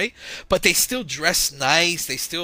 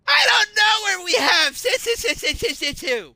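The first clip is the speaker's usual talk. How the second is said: mocking yell back; 6 dB louder than usual. Clerk mocking the old lady back, high shouted parody. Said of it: "And I'm like".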